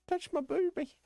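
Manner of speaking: very high, silly squeak; squeaky mock voice. Streamer mocks a groper in a high squeak. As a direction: squeak it like a cartoon.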